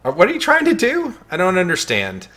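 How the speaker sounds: high squeaky chipmunk voice. Acted. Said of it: "quit using that chipmunk voice".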